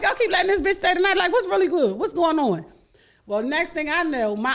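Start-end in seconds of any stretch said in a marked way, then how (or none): 0.0-2.7 mock scolding. Retold scolding of aunt's children.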